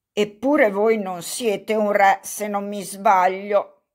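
ordinary delivery, nothing marked

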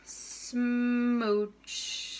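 long, stretched-out smooch; big final smooch. Acted. Kiss-kiss fish ends the story, drawn-out playful smooch. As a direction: hold the vowel and finish on a long ch.

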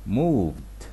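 moved said as one syllable ending in a soft "d"; moved with a short final d; d-sound ending. Shown as a demonstration. Teacher demonstrating the ending of moved for learners. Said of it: "نسمع كده".

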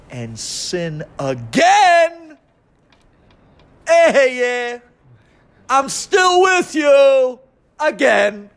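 slow, high, loud preacher's cry; fervent preaching. Acted. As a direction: build to a pleading shout of comfort. Preaching climax, God's promise.

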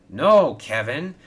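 cross. A narrator's reading. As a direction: cut Kevin off crossly. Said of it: "Victor wheezed crossly".

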